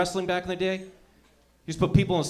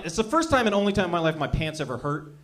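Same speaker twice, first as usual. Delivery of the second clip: usual speaking voice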